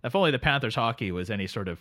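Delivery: sleepy Canadian drifter greeting his pal; Canadian accent. Acted. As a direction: play the neighbourly Canadian couch guest. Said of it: "my very cold Canadian heart".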